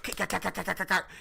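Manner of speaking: high, choppy repeated stab sounds; imitated stabbing noises. Reteller showing them stab her up, staccato mock noises. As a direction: rattle off stabs as sound effects.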